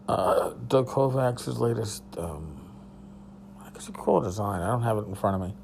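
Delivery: ordinary talk, nothing marked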